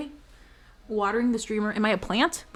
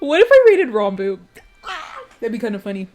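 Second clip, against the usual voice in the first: giddy; 2 semitones higher, 8 dB louder than usual. Real, not acted. Mischievous raid idea.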